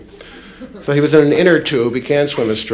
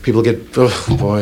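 ordinary talk

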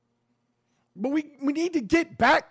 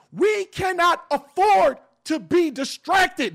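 emphatic; 6 semitones higher, 5 dB louder than usual. Real, not acted. Rallying his audience.

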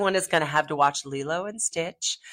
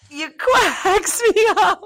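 laughing; 10 dB louder, slower than usual. Real, not acted. Host laughing through repeated punchline.

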